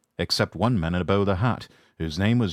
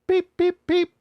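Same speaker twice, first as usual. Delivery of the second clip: imitated whistle; 4 dB louder, slower than usual.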